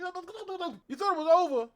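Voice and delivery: high, loud, fast streamer rant; xQc impression. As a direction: hammer it like a hyped streamer. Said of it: "my X Q C impression".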